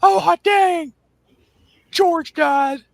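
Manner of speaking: high, squeaky Cornette voice; Cornette impression. Bruce's friend on the phone, in a high squeaky whine. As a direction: squeal it out like a frantic southern manager. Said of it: "he goes".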